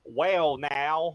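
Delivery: loud, high put-on Southern twang; hammed-up Southern accent. Shown as a demonstration. Trying out the requested accent, broad Southern accent. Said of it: "Can I even do a Southern accent?".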